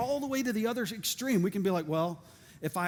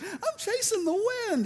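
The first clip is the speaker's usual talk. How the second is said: squeaky high goofy reply; silly runner voice; 12 semitones higher than usual. Retold or imitated. Runner in the park answering a passerby, high goofy voice. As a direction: answer like a clueless jogger.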